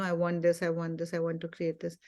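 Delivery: a shy, half-swallowed wish list; sheepish. Retold or imitated. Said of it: "really sheepishly speaking".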